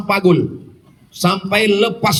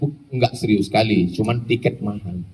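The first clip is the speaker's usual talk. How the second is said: low, soft deadpan punchline; deadpan joke; 10 semitones lower, 6 dB quieter than usual. Acted. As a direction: drop the punchline quietly, low. His joking reply to a mother. (lelucon datar, pelan dan rendah)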